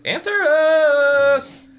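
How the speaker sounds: very slow, high, loud drawn-out shout. Acted. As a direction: scream the demand at him. Repeated demand to father.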